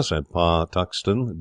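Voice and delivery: plain reading voice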